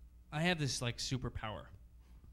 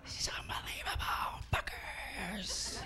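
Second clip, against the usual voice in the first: excited whisper, much softer; inner whisper; 7 semitones higher, 11 dB quieter than usual. Retold or imitated. Comic at the urinal whispering his excitement. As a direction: whisper it so the celebrity can't hear. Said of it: "I'm like".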